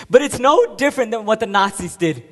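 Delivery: building to a high, full shout; furious outburst. Acted. Soldier comparing his act to the Nazis, furious shout. Condemns himself, likening it to Nazis.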